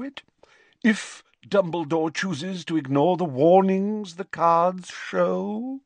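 put-on an eccentric old prophetess recounting; dreamy seer. A narrator's reading. Trelawney voice.

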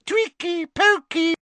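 very high gleeful poltergeist cry; happy Peeves. Acted. Peeves goading the fighting elves, very high and happy. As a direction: egg them on with delight.

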